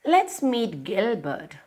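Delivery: plain reading voice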